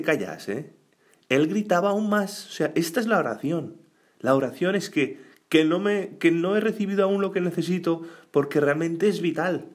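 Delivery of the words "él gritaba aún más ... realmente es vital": fervent preaching. Acted. Preacher builds to intense prayer.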